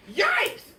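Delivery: high, loud yelp of fright; frightened kids. A narrator's reading. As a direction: shriek in terror. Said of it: "cried the kids".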